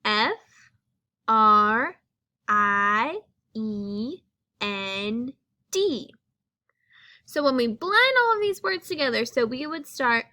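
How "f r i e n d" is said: slow letter-by-letter F R I E N D; spelling aloud. Shown as a demonstration. Teacher to class, slowly spelling friend. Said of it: "Friend is spelled".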